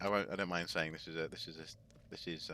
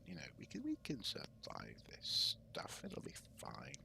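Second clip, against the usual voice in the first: whispering; 4 semitones higher, 12 dB quieter than usual. Pretend. Soothing the monster jokingly.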